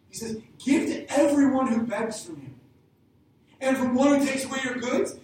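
loud, driving sermon build-up; preaching at full force. Acted.

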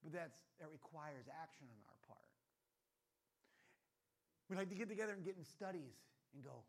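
normal talking voice

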